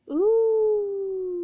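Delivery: high, loud ghostly hoot from the attic; spooky hoot. A narrator's reading. Noise from the attic scaring the new owner, eerie hoot. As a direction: moan out a long eerie hoot. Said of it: "came a frightening noise".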